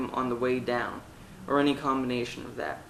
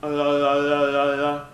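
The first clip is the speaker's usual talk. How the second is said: jaw-shaking la; 4 dB louder than usual. Shown as a demonstration. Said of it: "shook our jaw like this".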